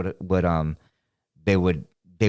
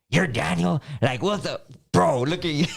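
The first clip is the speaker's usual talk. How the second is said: gawk at him in disbelief; a manager amazed the boss is Mexican; shocked manager; 7 semitones higher than usual. Retold or imitated. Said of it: "the manager would come out and be like".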